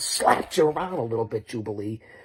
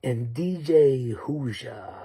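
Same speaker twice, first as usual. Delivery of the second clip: ordinary talk, nothing marked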